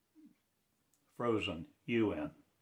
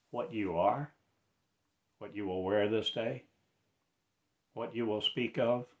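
usual speaking voice

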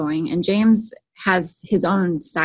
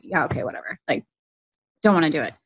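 dismissive; 3 semitones lower, faster than usual. Retold or imitated. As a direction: play an uninterested husband shrugging it off. Husband brushing off eager wife.

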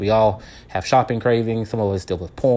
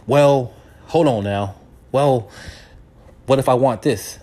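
imitating uncle protesting; 3 semitones higher, 5 dB louder than usual.

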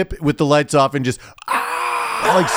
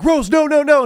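much higher, pleading cry to Rose; mock pleading; 14 semitones higher, 3 dB louder than usual. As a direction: beg Rose to stop, hammed-up. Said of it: "him being like".

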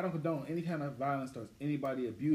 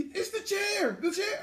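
hyped, much higher, laughing wrestler voice; WWE wrestler impression; much slower than usual. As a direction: ham it up like a wrestling promo, laughing. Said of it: "You seen W W E?".

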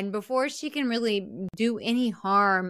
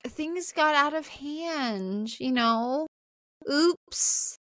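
mock sheepish; 6 semitones higher, much slower than usual. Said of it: "Nancy kinda stands up and she's like".

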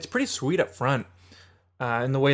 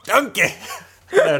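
laughing; 7 semitones higher, 5 dB louder, slower than usual. Retold or imitated. Shrek quote, laughing.

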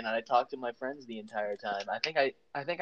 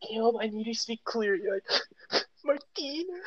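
mock crying; 10 semitones higher than usual. Imitating crying voice.

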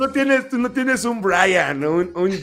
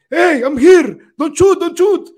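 gringo gamer; 7 semitones higher, 8 dB louder, slower than usual. Retold or imitated. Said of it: "Y dice".

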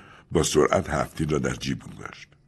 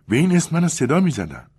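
lightly voiced Julia; 11 semitones higher, 4 dB louder than usual. Julia answers.

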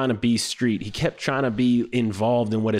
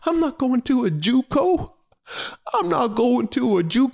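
high, whiny tearful protest; hammed-up weepy whine; mock tearful; 13 semitones higher than usual. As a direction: imitate a kid tearing up in refusal. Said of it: "literally teared up and said".

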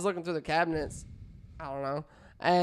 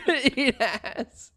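laughing; 6 semitones higher, much slower than usual. Real, not acted. Laughing echo of partner.

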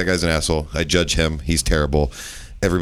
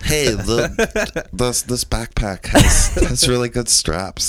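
put-on slow, higher kid voice; cute little daughter; 5 semitones higher, much slower than usual. Dad imitating daughter chatting about her backpack, cute and slow. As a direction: imitate a child's halting small talk. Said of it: "that's the kind of shit she says".

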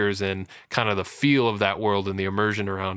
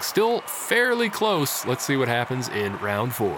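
ring announcer; 2 semitones higher than usual. Acted. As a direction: call out the scores like a ring announcer. Host announces final round scores.

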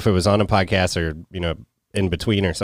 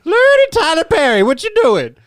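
much higher, loud squawking Lordy; mock Madea voice; 6 dB louder than usual. Calling to Tyler Perry, mock Madea voice. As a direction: cry out like Madea.

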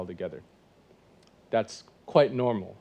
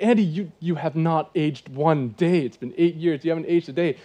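enthusiastic compliment; 5 semitones higher, 7 dB louder than usual. Retold or imitated. Complimenting friend after eight years.

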